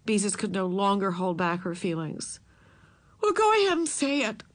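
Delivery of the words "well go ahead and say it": high, tearful outburst; angry and tearful. A narrator's reading. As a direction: snap it out through tears. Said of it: "she burst out in anger and in tears".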